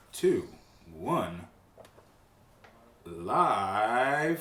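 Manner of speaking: slow, stretched show intro; show host intro. Acted. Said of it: "counts in the show opening".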